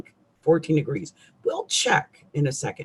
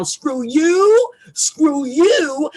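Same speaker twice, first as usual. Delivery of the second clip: loud, high cursing shout with a crying edge; angry cursing; 11 semitones higher, 5 dB louder, slower than usual. Acted. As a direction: let it out, curse everyone loud and high. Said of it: "I gotta do it right now".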